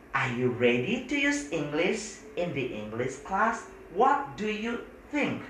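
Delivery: slow, careful English example; teacher reads the book's example slowly to the class; slow model sentence. Shown as a demonstration. Slow demo question. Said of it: "teacher models example".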